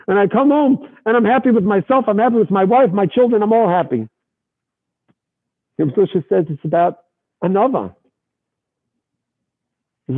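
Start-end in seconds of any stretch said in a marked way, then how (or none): 0.1-4.1 high, delighted telling; higher happy lilt; cheerful. Retold or imitated. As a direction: beam with contentment. Zusha content after wedding.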